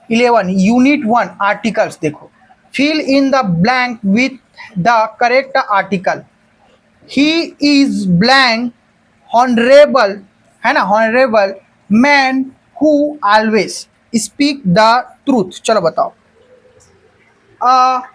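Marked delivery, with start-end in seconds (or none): 2.7-6.2 very slow, word by word dictation; dictating each word slowly to the class. Shown as a demonstration. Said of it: "teacher dictating the question slowly". 7.1-15.6 very slow, spaced-out reading of the sentence; slow classroom read-aloud; slow dictation. Shown as a demonstration. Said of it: "teacher dictating the sentence".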